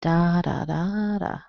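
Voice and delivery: "Da, da, da" murmured softly as DA-da-DAA-da; stress pattern. Shown as a demonstration. Voices stress pattern while searching list.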